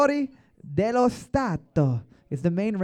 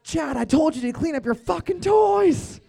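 high, loud scolding mother voice; shouting elephant mom; 10 semitones higher, 3 dB louder than usual. Pretend. Host plays elephant mom scolding her kid, high mock shout. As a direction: screech it like a fed-up mom, hammed up.

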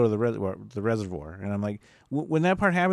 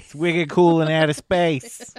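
slow, higher-pitched mock Boston accent; 5 semitones higher, 4 dB louder, slower than usual. Co-host playing a Boston alien in outer space, slow mock Boston accent. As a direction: stretch it out in a hammed-up Boston accent. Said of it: "a Boston accent".

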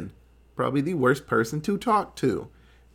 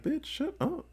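high, soft mock hiss of shut up; mock hushed snap; 12 semitones higher, 9 dB quieter than usual. Doctor silences his assistant, soft mock snap. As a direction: hush her sharply but quietly. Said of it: "he's like".

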